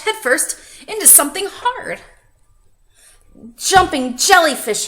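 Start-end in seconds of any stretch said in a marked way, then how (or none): 3.6-4.9 loud, high cry; cried out. A narrator's reading. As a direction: cry out after the crash. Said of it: "he cried".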